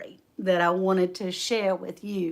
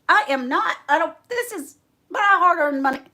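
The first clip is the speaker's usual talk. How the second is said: higher, louder mock objection; indignant protest; 8 semitones higher, 6 dB louder than usual. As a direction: act out an affronted refusal. Acts friend protesting the Lord's order.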